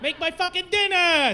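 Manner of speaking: high, hammed-up mock Italian accent; over-the-top Italian character voice; Luigi. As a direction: wail it in a cartoon Italian accent, stretching the endings. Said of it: "See, that's Luigi".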